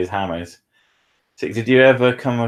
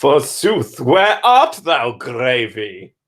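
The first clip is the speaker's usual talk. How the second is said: hammed-up Shakespearean; 2 semitones higher, 4 dB louder, much slower than usual. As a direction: declaim it like a ham actor doing Shakespeare. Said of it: "And Shakespeare".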